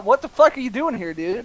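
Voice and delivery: usual speaking voice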